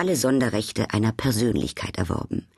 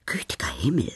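whispered thought; 4 semitones lower than usual. A narrator's reading. Duchess privately dismayed by the palm reader, whispered thought. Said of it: "dachte die Herzogin".

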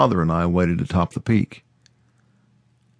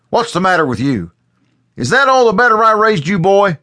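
loud, high scolding shout; angry father; 13 semitones higher, 7 dB louder than usual. A narrator's reading. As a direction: tear into the boy for his language. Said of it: "face was red with anger".

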